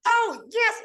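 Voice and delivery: high squeaky try at her voice; Boomy Moore voice. Retold or imitated. Imitating Boomy picking up the phone, high. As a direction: pitch way up into a friend's voice. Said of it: "my Boomy Moore voice".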